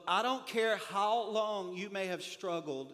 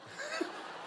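squeaky high laugh at his own joke; high burst of laughter; 6 dB quieter than usual. Real, not acted. Pastor cracking up at his own story. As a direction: let a high giggle escape.

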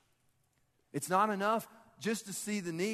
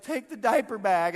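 loud, higher, imitated command; urgent shouted order; 5 semitones higher, 9 dB louder than usual. Mom wading in lake to jogger, urgent shout. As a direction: holler it like a harried mom.

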